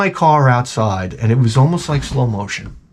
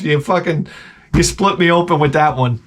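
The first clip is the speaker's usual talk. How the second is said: ordinary talk, nothing marked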